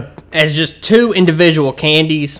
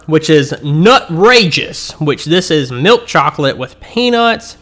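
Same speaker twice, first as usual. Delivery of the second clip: ordinary delivery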